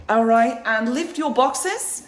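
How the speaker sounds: British TV host, slipping into Australian; loud, high, drawn-out British-Australian accent; attempted British accent. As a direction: announce it in a wobbly British accent. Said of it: "a little bit more Australian than it was British".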